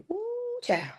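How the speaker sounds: high, soft falsetto exclamation; high breathy knowing exclamation. Real, not acted. Self-amused exclamation about her fight.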